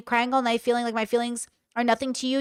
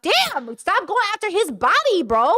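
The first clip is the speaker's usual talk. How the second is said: much higher-pitched, exclaimed objection; shocked protest; 8 semitones higher than usual. Real, not acted. Reader protesting a body insult, shocked and high-pitched. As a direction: leap up high in appalled disbelief.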